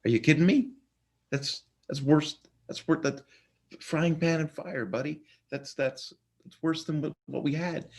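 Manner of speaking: a prophet shrieking at God that it's worse; outraged disbelief. Retold or imitated. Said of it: "And Habakkuk goes".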